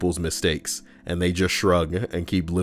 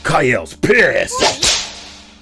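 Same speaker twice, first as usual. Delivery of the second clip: slow, loud dramatic announcer; 7 semitones higher, 7 dB louder, much slower than usual. Acted. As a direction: announce it slowly, big. Segment jingle announcement.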